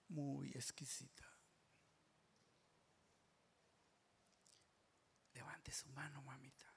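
soft, breathy hush; reverent whisper. Real, not acted. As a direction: whisper as if in holy presence. Whispered prayer in God's presence.

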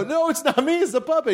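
high, flustered denial; stagey, high-pitched defensive protest; mock panicked excuse. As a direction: plead innocence in hammed-up alarm. Acted panicked excuse in bit.

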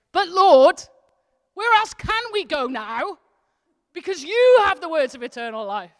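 high strained cry of reluctant surrender; desperate, exasperated plea. Shown as a demonstration. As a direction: plead like there's truly nowhere left to go. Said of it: "There's a difference in it".

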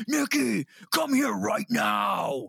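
high, loud, slow mock shout of her name; hammed-up high, loud, slow demanding yell; imitated psychotic yelling. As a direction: yell it like a man losing it, stretching the words. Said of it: "Charlie Zelenoff just starts freaking out".

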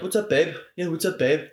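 cool guy. Retold or imitated. Film character greeting his date, mock cool-guy voice. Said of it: "being all, like, cool guy".

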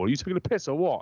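high, fast indignant wife; high mock outrage. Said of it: "And she was like".

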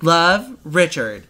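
slow drawn-out reading of the card. Retold or imitated. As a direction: draw out Love, Richard. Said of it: "she's like".

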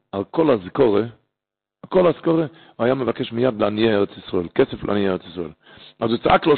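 ordinary delivery

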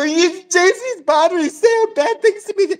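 high, whiny tattling to a teacher; mock crying. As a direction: imitate a tearful girl complaining. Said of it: "She's, like, crying".

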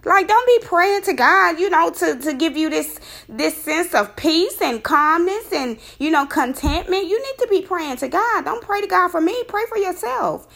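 high, incredulous lecturing; retold scolding of the boyfriend, pitched high; exasperated scolding. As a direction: set him straight, fed up. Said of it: "And I'm like".

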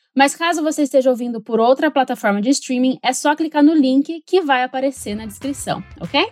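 ordinary talk, nothing marked

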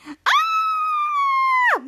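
piercing, very high held shriek; long squeal on one high note; mock panicked scream. Mika sees the vision coming true, mock panicked scream.

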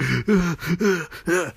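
repeated hammed-up gasps; exaggerated choking gasps; mock gasping. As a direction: act out gasping for air. Gasman falls, gasping for air.

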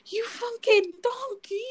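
high, slow mock-angry insult; drawn-out, hammed-up outrage; imitated anger. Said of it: "I remember you got angry".

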